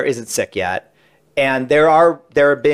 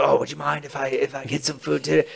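softly voiced polite request; hushed quote of a courteous question; Al Pacino; 7 dB quieter than usual. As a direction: ask it meekly, much quieter. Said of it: "and he's like".

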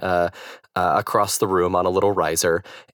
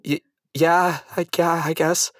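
higher-pitched, halting, unsure agreement; imitated hesitant reply; 7 semitones higher than usual. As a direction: stumble into a wary yes, pitched up.

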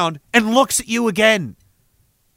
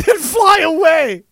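laughing; 8 semitones higher, 4 dB louder, slower than usual. Real, not acted. Laughs reading chat.

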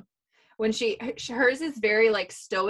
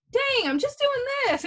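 much higher, louder irritated whine; annoyed whine; 4 dB louder than usual. Retold or imitated. Wife whining back at husband's question, annoyed. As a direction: whine it, fed up with being asked.

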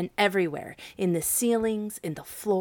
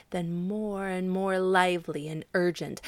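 usual speaking voice

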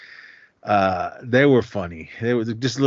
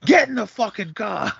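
much higher, hammed-up shouted order; mock mobster yell; 13 semitones higher than usual. Boyfriend losing patience with his girlfriend, hammed-up yell. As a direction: snap it like a hotheaded wiseguy. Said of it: "He goes".

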